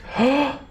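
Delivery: quick high gasp of shock; sharp surprised gasp. Acted. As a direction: gasp as if startled. NPC lurches back surprised.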